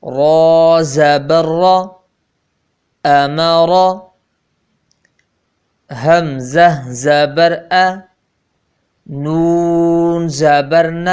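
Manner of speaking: measured, drawn-out spelling recital; slow syllable drill. Shown as a demonstration. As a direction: spell each letter out slowly for pupils. (धीमा तहज्जी वाला पाठ)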